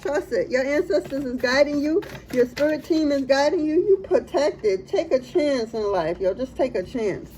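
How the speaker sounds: ordinary talk, nothing marked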